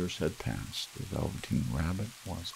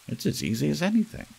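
quick, higher boastful voice; boastful rabbit; 9 semitones higher, 5 dB louder, faster than usual. A narrator's reading. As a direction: brush it off as easy, fast. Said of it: "said the furry rabbit".

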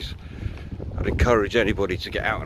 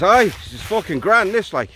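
high, blithe mock Irish voice; enjoy it in a bad Irish brogue; terrible Irish accent; 8 semitones higher than usual. Paddy having a great time falling, hammed-up Irish accent. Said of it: "apologies for the terrible accent".

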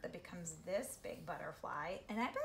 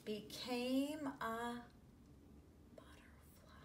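slow build, then butterfly whispered; slow fading into a whisper; whispered reveal; 5 semitones higher, slower than usual. A narrator's reading. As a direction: hush the big reveal to a whisper.

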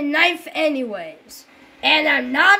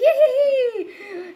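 evil doll cackle; 8 semitones higher than usual. Pretend.

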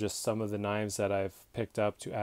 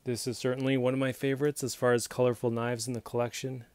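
usual speaking voice

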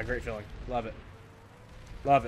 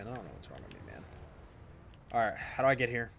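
ordinary talk, nothing marked

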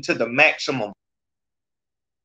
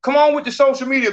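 mocking call-out; 10 semitones higher, faster than usual. Real, not acted. Host calling out the commenter.

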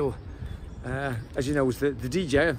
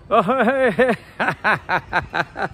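high, loud laughing hey hey; excited greeting; 6 semitones higher, 9 dB louder, much slower than usual. Real, not acted. Vlogger spotted on the street, calling out loud and laughing. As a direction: call out to a friend who spotted you.

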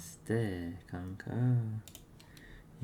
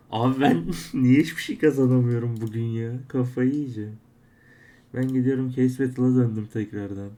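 louder, half-laughing complaint at his bad luck; frustrated outburst; 3 semitones higher, 9 dB louder than usual. Real, not acted. Streamer fed up with losing every case, loud laughing complaint. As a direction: vent loudly, laughing in disbelief.

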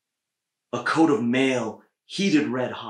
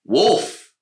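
Blaze calls the wolf, loud and high; commanding call; 8 semitones higher, 11 dB louder than usual. A narrator's reading. Blaze commands the wolf, loud ringing call. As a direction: summon the wolf with authority. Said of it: "Blaze said".

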